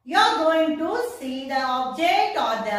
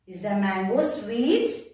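"is the mango sweet?" rising on "sweet"; question tune; 7 semitones lower than usual. Shown as a demonstration. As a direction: ask "is the mango sweet?" with the voice going up on "sweet". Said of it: "I'm asking someone".

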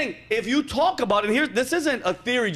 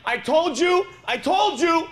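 high, singsong I told you; higher told-you-so taunt; mock gloating; 7 semitones higher, slower than usual. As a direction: gloat over everyone. Imagined gloating quote.

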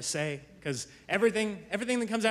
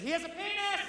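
put-on higher, pointed toddler accusation; accusatory little girl; 11 semitones higher, 6 dB quieter than usual. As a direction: announce it like a tiny accuser. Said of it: "she's just like".

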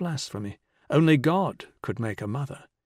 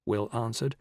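usual speaking voice

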